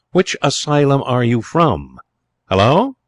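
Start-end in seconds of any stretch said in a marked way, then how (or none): 2.5-3.0 high, loud questioning hello; surprised exclamation. A narrator's reading. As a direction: call it out in sudden surprise. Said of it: "exclamation was one of surprise".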